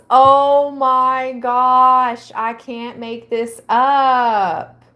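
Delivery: real astonishment, slow and loud; amazed. Real, not acted. Reacting to viewers that the card matches, amazed.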